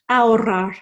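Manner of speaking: ahorrar said "a-orrar" with a trill; Spanish ahorrar with trilled RR, no H; silent H, rolled R. Shown as a demonstration. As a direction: roll the RR, skip the H in ahorrar. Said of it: "we're gonna roll those R's".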